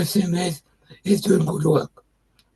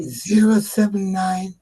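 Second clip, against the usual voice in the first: dictating; slower than usual. Real, not acted. Giving phone number slowly.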